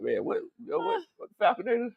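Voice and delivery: high, hushed puzzled wondering; puzzled mock voice. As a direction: act baffled, half under breath. Retelling his own puzzled reaction.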